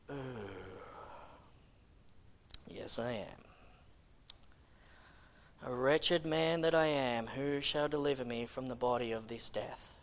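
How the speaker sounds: low, hushed admission of guilt; humbled confession. Acted. David answering the prophet's charge, quiet stricken confession. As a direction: sink into a quiet, heavy lament. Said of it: "and David went".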